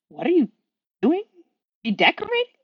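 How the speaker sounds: high, incredulous squawk; disbelieving demon voice; Crowley's voice. Acted. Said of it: "I will do voices".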